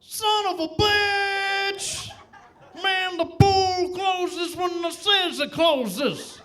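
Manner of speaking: high, drawn-out griping; slow, hammed-up high complaint; mock outraged yell. U S swimmer at pool closing, high outraged whine.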